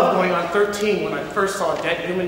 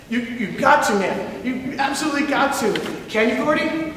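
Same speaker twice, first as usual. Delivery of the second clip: pleading; 6 semitones higher than usual. Acted. Vern urges them.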